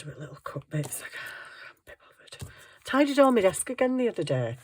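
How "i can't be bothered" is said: slow, whispered grumble; whispered aside. Real, not acted. Crafter answering herself about little cut bits, whispered aside. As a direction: let it sink into a slow whisper. Muttered answer to her own question.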